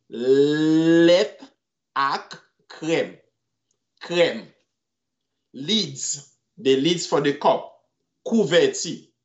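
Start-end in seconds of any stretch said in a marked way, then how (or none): 0.1-4.5 drawn-out syllables. Shown as a demonstration.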